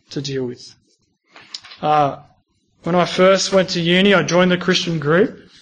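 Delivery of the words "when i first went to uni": ordinary delivery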